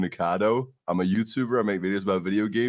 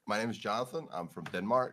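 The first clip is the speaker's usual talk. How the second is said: barely audible, almost whispered introduction; faint, hushed voice; very quiet; 13 dB quieter than usual. Real, not acted. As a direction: introduce yourself far too softly. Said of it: "that's the quietest mic I've ever heard".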